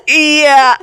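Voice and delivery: embarrassed laughing yes. Retold or imitated. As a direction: laugh the word out. Retold answer, bursting into laughter.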